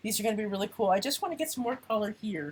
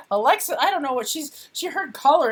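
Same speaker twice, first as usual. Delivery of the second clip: words through laughter; laughing; 5 semitones higher, 6 dB louder than usual. Real, not acted. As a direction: talk while still giggling. Still laughing at Alexa.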